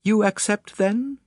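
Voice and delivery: very high, eager lady. A narrator's reading. Lady seizing on detective's answer, eager. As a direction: pitch it right up, hopeful. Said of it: "she cried eagerly in response".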